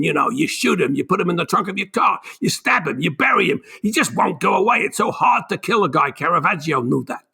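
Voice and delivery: lightly put-on New York accent, Scorsese style; a hint of Scorsese's New York accent, quoting his interview; terrible Martin Scorsese accent. Quoting Martin Scorsese to the interviewer, mock New York accent. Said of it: "Terrible Martin Scorsese accent".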